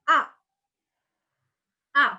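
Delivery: "A" cut short with a hard drop; stamped falling tone. Shown as a demonstration. Teacher repeating tone four, abrupt falling tone.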